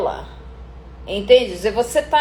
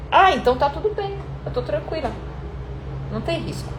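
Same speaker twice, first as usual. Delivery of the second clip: calm, no risk; 4 semitones higher, 4 dB quieter, faster than usual. Shown as a demonstration. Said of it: "vou baixar o risco".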